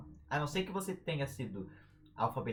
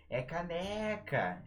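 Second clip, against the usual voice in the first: CANECA with a long, higher NE; stretched stressed syllable; 4 semitones higher, much slower than usual. Shown as a demonstration. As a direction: hold the middle syllable of "caneca" like a call. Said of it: "a sílaba que a gente estende".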